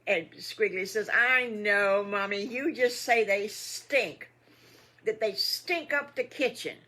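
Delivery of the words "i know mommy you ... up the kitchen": put-on high, loud, drawn-out kid voice; emphatic little piglet; complaining piglet. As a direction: whine about the stink, loudly. Piglet complains sardines stink.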